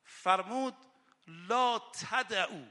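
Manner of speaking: loud, impassioned rowza sermon; rising impassioned sermon voice; fiery preaching. Acted. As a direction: preach it out with full fervour. Impassioned preaching about duty.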